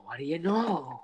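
breathy whispered repeat; whispered echo. Retold or imitated. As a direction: whisper the line back. Friend echoes the impression.

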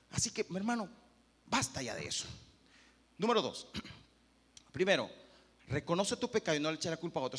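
ordinary talk

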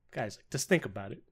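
ordinary talk, nothing marked

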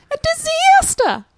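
very high, loud, drawn-out Long Island accent. Shown as a demonstration. Guest repeating her Kitchen Nightmares impression, Long Island accent. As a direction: wail it slowly in hammed-up Long Island. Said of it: "the woman was really Long Island".

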